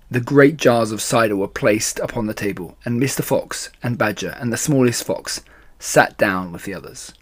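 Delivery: ordinary delivery, nothing marked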